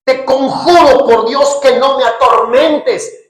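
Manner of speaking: loud, slow tormented plea; demon's voice. Acted. The voices inside the man begging Jesus, loud and slow. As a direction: beg in the demon's voice. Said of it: "Las voces comenzaron a salir de él".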